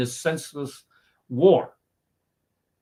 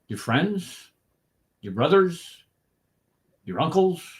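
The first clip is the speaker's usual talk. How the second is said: normal talking voice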